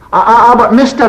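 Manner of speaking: high, loud Scots lad insisting; a Scots laddie shouting for attention; Scots boy shouting. Retold or imitated. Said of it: "he shouted".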